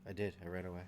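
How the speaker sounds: distant, quiet voice off mic; speaking from far back in the room; far away. Real, not acted. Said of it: "Sound like you ran away".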